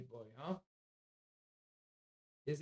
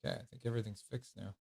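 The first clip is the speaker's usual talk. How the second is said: normal talking voice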